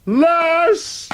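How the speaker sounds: high, rising crowd cry; calling out. Retold or imitated. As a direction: call out like a heckler at a concert. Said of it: "I've really wanted to go".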